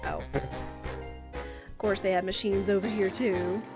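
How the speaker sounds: ordinary talk, nothing marked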